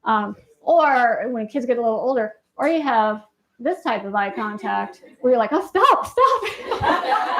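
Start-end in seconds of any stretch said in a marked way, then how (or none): 5.5-7.4 high, laughing "stop, stop"; uneasy laughing plea; laughing discomfort. Retold or imitated. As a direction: squirm away from the stare, laughing. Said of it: "It makes me feel so uncomfortable".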